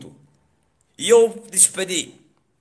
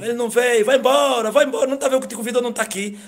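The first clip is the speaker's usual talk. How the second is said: higher, louder, rushed mocking voice; taunting devil; 7 semitones higher, 5 dB louder, faster than usual. Devil urging the man to give up and leave, taunting. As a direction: push him to leave, impatient. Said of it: "o diabo falou comigo".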